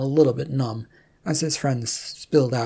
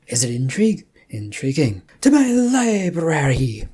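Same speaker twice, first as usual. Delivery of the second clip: louder, building to a grand call; sudden delight; 6 dB louder than usual. Acted. Rum thrilled by the mystery, calling everyone to her library. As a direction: swept up by intrigue, sweeping off.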